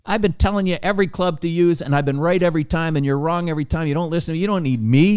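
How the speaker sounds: high-pitched exasperated rant; really frustrated. Retold or imitated. Caddy telling off the golfer who ignores him, frustrated. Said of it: "this guy's getting really frustrated".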